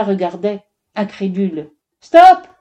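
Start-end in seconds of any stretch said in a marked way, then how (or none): 2.0-2.5 sharp, high, loud cry; shouted. A narrator's reading. Onlooker trying to stop the felling, shouting. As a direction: yell to halt them at once. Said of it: "cria quelqu'un".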